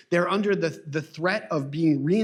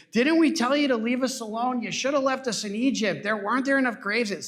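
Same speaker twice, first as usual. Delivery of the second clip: higher, whining mock of the Israelites; whiny complaint; 6 semitones higher than usual. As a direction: complain like ungrateful ex-slaves. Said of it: "So they're really whiny here".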